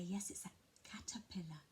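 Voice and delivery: put-on soft whisper for a toddler; whispered naming. Shown as a demonstration. Parent softly confirming the word to a toddler, whispered. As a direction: gently whisper the word back.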